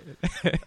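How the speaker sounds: a short high burst of real laughter; laughing. Real, not acted. Laughing at Xbox going crazy.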